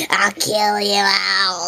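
low, slow pretend menace; slow mock threat. Pretend customer slowly threatens the whole restaurant in mock menace. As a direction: drag out the threat like a cartoon villain. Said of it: "don't talk to me like that".

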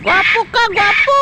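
very high squeaky coaxing call; high pet-talk voice; high bird-talk. Real, not acted. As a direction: sing it up high to coax a bird. Praising the parrot.

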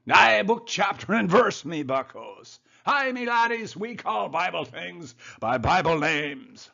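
high, loud mock Scots-Irish brogue; jaunty cartoon Scots-Irish accent; Scot-Irish immigrant accent. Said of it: "in his Scot-Irish, uh, immigrant accent".

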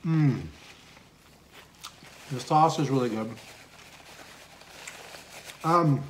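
chewing while talking; speaking between bites; mouth full of pizza. Real, not acted. Seen 5: Chews and bites pizza throughout the words; cheeks bulge with food.

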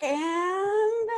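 put-on lively read-aloud voice for children; story narration. A narrator's reading. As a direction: tell it like a picture book to a class. Reads picture book aloud.

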